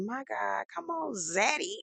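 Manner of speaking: soft, high, slow flirty sigh at a fine man; flirty swoon. Retold or imitated. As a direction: swoon quietly over a handsome dad. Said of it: "I said".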